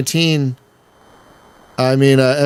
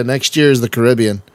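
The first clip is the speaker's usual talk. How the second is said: ordinary talk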